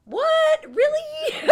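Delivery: very high, loud squeal of disbelief; mock shocked disbelief. Storyteller mocking own shock at getting pregnant, high incredulous squeal.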